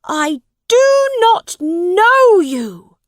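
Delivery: high, loud, slow furious denial; angry prince. A narrator's reading. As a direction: hurl it out angrily in a high voice. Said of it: "said Edward angrily".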